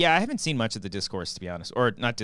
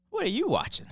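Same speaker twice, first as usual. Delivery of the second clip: much higher, puzzled question; high-pitched question. Retold or imitated. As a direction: squeak it out, puzzled. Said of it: "I'm like".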